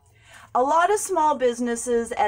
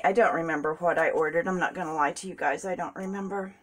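normal talking voice

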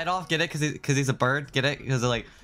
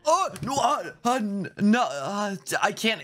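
high, stammering frustration; too upset to get words out; frustrated; 8 semitones higher than usual. Real, not acted. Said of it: "I'm that mad".

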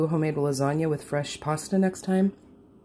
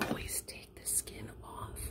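whispered secret; 20 dB quieter than usual. Real, not acted. Conspiratorial tip to viewers.

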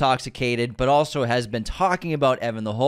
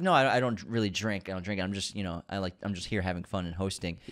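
much lower and softer; quiet; 7 semitones lower, 9 dB quieter than usual. Retold or imitated. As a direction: say it softly, almost under breath. Jules voiced quietly.

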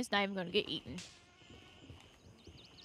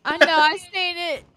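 loud, high shouted reply; yelling across the room; 8 semitones higher, 13 dB louder than usual. Real, not acted. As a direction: shout back from upstairs. Answering shout from upstairs.

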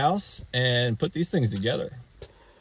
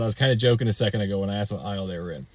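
fast serious aside; 3 semitones lower, faster than usual. Retold or imitated. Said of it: "Lady, in all seriousness".

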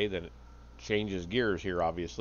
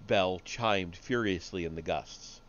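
usual speaking voice

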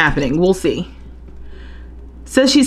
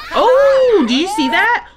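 startled oh; 12 semitones higher than usual. Real, not acted. Player catching the fun meter drop, a high startled oh. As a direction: yelp it out as you spot it.

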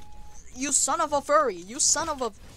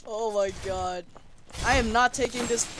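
ordinary talk, nothing marked

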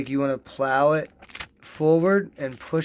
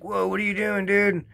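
higher, mock-startled viewer; shocked viewer; 7 semitones higher than usual. Some viewers reacting to the cut bag, mock startled. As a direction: play the baffled onlooker, lightly. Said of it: "some of you out there are like".

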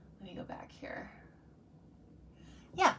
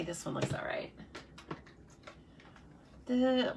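ordinary talk

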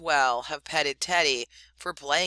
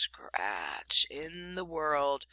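softer and slow, scratch stretched out; faint, drawn out; 4 dB quieter, much slower than usual. A narrator's reading. The narrator lingers on the faint sound, slow and soft. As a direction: let scratch in the world linger slowly and softly. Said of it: "the faintest scratch".

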